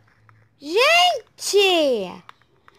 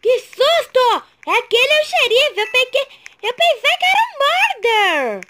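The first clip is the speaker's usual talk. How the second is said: shaken and excited; 5 semitones higher than usual. Real, not acted. After the scare.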